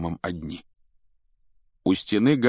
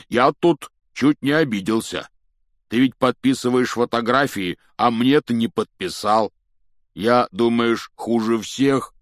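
loud, raised character voice; big voice, slowing at the end; giant, mock offended; 4 semitones higher, 6 dB louder than usual. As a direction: complain with put-on hurt.

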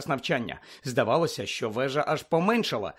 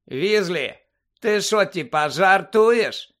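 higher, louder taunting voice; a slightly raised, scornful challenge; mild jeer; 5 semitones higher, 6 dB louder, slower than usual. A narrator's reading. Malfoy butting in behind the friends, jeering. Malfoy sneers at Ron's question.

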